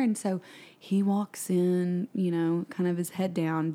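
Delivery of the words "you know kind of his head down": ordinary delivery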